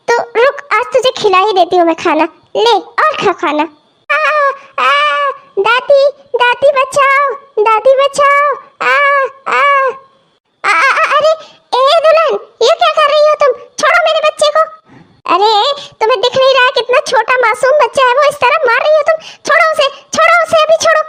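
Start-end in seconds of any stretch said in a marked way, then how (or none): 4.1-4.9 put-on 1 child's pained cries. Child crying out while beaten. 5.6-8.5 put-on 1 child pleading for help. Child begging grandma for help. 8.8-11.3 put-on 1 child's pained cries. Child crying out while beaten. 11.8-21.0 put-on 1 outraged old grandmother. Grandmother stops the beating.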